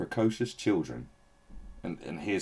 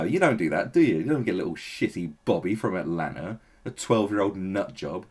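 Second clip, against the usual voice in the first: usual speaking voice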